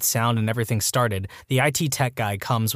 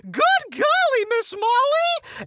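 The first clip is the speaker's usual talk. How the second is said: very high-pitched, drawn-out mock scream; screaming teacher; slower than usual. Teacher bursting in on two kids on the couch, mock scream. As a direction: screech it high, stretching the last word. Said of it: "before the teacher screams".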